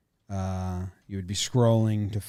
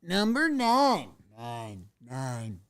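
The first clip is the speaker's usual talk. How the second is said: ESPN countdown voice; much slower than usual. Acted. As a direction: boom it out like an old sports countdown. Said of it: "the old E S P N countdown voice".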